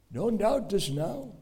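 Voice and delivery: ordinary talk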